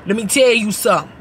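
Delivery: ordinary delivery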